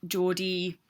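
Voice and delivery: normal talking voice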